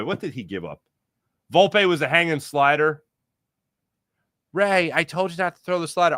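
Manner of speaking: ordinary talk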